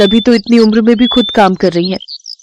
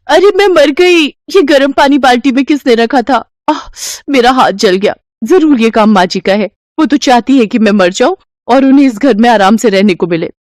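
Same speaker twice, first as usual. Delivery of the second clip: high cry then higher, accusing; mock pain and blame; 5 semitones higher than usual. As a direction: fake the burn, then blame. Daughter-in-law claims burnt hand.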